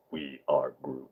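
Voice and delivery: low, soft, hint of Groot voice; slow mock Groot. Streamer to viewers as a joke, lightly voiced Groot. Repeats catchphrase slowly, low.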